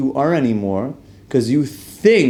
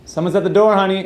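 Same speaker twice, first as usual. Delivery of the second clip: high sing-song wife voice, much higher; wife calling out; 8 semitones higher, faster than usual. Retold or imitated. Wife calls husband to the door, higher sweet voice.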